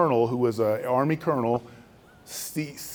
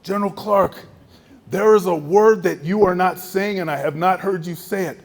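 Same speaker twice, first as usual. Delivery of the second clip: higher and louder, pressing hard; stern accusing general; 6 semitones higher, 5 dB louder than usual. Retold or imitated. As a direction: corner him on the missing word. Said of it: "one of his generals who's sitting goes".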